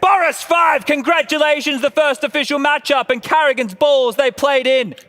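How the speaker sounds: big announcer call; announcer hype. Acted. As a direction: hail the champion. Host announces winner loudly.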